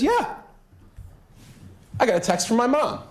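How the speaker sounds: high mock voice of the son; mock Chandler. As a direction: imitate him, pitched high and innocent. Voicing Chandler's reply.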